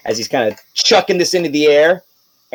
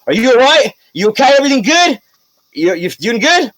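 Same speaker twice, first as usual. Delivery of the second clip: eager mayor checking in; 8 semitones higher than usual. Acted. Mayor checking on Wendlyn, higher and eager. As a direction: fuss over her like a worried suitor. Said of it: "he goes to".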